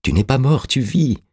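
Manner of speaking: high-pitched, urgent contradiction; insistent cry. A narrator's reading. Orastès insists the dead man lives, crying out high. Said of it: "s'écria Orastès".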